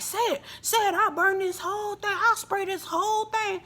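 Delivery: high, dare-you challenge; imitating hyped Marceau; mock hyped Marceau. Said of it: "Marceau got hyped and was like".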